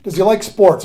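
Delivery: imitated impatient prompting; exasperated sponsor. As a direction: ask it as if spelling out the obvious. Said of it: "when they roll their eyes".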